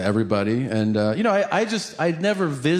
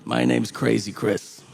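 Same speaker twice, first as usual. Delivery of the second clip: menacing convict; 4 semitones lower than usual. Acted. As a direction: introduce yourself as a creepy inmate, low and deliberate. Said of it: "Chris is like".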